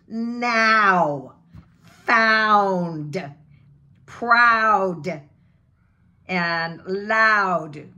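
loud, stretched AH in Now, Found, Proud, loud; huge open vowel. Shown as a demonstration. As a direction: belt each word with a huge open OW. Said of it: "like it's huge here".

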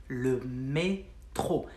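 "le métro" stretched, mé held long before tro; slowed repeat. Shown as a demonstration. Teacher repeating the word for subway to learners, slow and split. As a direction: draw out "le métro" in separate syllables.

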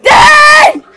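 high, loud, cracking yells, very slow on the curse; voice cracking shout. Real, not acted. Teen shouting at his friends as his voice cracks. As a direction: voice breaks upward mid-shout. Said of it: "I get voice cracking".

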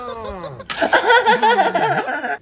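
sudden high burst of laughter; a high cackle that falls away. Real, not acted.

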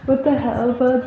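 ordinary delivery

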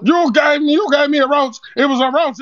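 mock angry accuser. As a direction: hammer her with the roach. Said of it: "why is he talking like Zeke off power".

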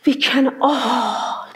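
slow, soft tearful whisper; awed whisper. Retold or imitated. Her friend realizing a truth, a tearful awed whisper. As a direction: breathe it in wonder.

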